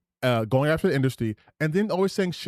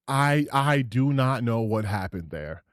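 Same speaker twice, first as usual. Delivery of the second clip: normal talking voice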